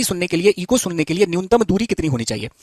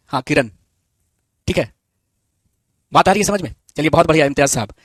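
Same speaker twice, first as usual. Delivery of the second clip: ordinary delivery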